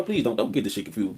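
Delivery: ordinary talk, nothing marked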